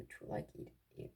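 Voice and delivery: ordinary talk, nothing marked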